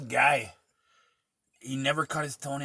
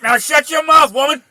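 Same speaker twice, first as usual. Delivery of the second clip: hammed-up gruff husband; 19 dB louder than usual. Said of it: "He's like".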